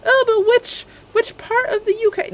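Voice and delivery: high-pitched, fussy British objector; mock British accent. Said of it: "whatever type of British accent".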